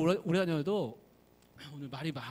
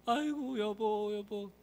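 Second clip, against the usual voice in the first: wailing; 4 semitones higher, slower than usual. Acted. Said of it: "펑펑 울면서".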